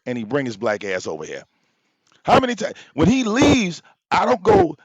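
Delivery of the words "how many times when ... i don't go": higher and louder, pressing the point; heated raised voice. Real, not acted. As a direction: push it up, exasperated and insistent. Heated rant at Tommy peaks.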